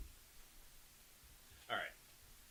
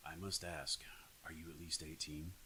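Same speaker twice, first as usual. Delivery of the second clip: creepy seductive voice; 8 semitones lower, 9 dB quieter than usual. Shown as a demonstration. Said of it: "How's, how'd that sound?".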